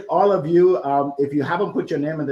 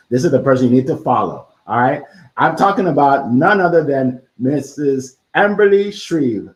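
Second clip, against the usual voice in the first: louder, fast build-up to a big name; hype announcer intro; 8 dB louder than usual. Real, not acted. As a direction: announce her like a boxing MC. Hyping up the next guest.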